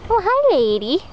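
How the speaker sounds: high sing-song pet voice; talking to sheep. Real, not acted. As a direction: coo at the animals playfully. Cooing at the sheep.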